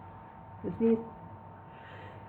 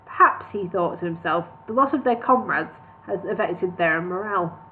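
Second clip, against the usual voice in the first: ordinary delivery, nothing marked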